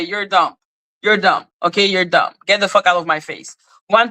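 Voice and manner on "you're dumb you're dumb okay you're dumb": ordinary delivery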